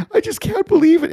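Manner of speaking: high, loud mock sobbing whine; imitating crying. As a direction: squeak it out like a tearful caller. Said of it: "And, like, just crying".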